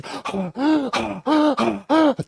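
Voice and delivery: big gasp for air, high; loud gasp. Retold or imitated. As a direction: suck in air hard and high. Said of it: "I'm out of breath".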